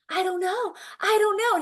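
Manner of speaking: put-on high-pitched, agitated child's protest; flustered repeat, rising in urgency; imitated panic. Pacing son answering mother about falling, panicky imitation. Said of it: "he's just panicking".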